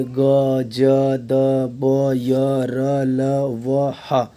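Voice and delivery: ग, ज, द, ब, य, र, ल, व, ह each said apart; voiced sounds demonstrated. Shown as a demonstration. Teacher listing voiced sounds for students, one by one. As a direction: sound out every letter separately, with pauses. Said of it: "ये घोष ध्वनि कहलाते हैं".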